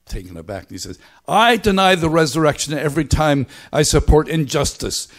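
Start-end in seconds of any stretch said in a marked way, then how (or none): 1.3-5.1 put-on loud, high, emphatic Belfast accent; Pete Rollins. Quoting the talk's confession, loud imitated Belfast accent. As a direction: declare it forcefully in a Belfast accent. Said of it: "there's the accent".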